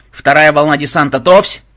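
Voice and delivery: loud order rising to a shout; barked standby order; military command. A narrator's reading. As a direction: call troops to readiness, punching the last word. Commander readies second wave for launch.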